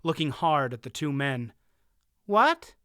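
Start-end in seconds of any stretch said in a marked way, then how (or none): 2.2-2.7 sudden high startled question; surprised. A narrator's reading. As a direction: blurt it out, startled. Boy startled.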